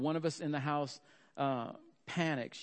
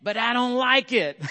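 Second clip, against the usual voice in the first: much higher and louder, an indignant yelled line; grudging protest; 10 semitones higher, 13 dB louder than usual. Retold or imitated. Wife yelling her reluctant obedience at her husband, indignant. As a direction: shout a sulky surrender. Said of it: "Connie said a classic line".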